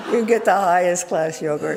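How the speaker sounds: laughing through the words. Real, not acted. Amused speaker laughing at fancy Bay Area yogurt. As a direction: chuckle while you talk about it.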